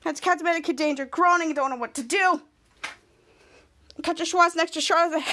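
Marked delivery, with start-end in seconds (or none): none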